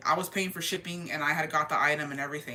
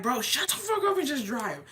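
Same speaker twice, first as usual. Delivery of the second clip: higher-pitched mock exasperated snap; retold exasperation; 6 semitones higher than usual. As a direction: act out fed-up bickering with the driver. Retold bickering with the driver.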